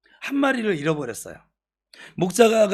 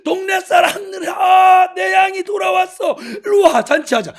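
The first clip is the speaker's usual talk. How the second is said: high, loud shout to the whole village; joyful calling out; 12 semitones higher, 9 dB louder than usual. Acted. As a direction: call everyone over, overjoyed at the find. Shepherd calls the villagers to celebrate. (동네 사람들을 부르듯 높고 크게 기뻐 외치기)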